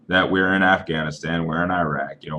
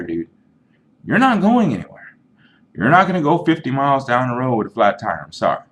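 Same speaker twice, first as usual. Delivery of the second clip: ordinary talk, nothing marked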